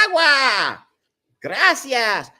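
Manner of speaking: high, loud mimicked children's reply; kids shouting back. Little kids at home answering Dora, exuberant mock shouting. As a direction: imitate little kids yelling at the TV. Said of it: "all the little kids at home".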